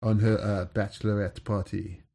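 put-on lightly done French Algerian accent; a touch of a North African French lilt. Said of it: "that's a French Algerian accent".

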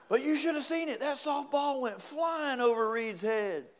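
very high, comic boast; excited bragging. Acted. Husband bragging about his softball hit, comic excitement.

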